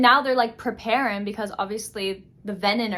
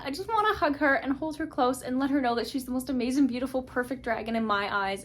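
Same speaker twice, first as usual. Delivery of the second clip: usual speaking voice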